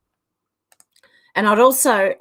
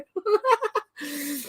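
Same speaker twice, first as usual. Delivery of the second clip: laughing; 8 semitones higher, 4 dB quieter than usual. Real, not acted. Laughing hard.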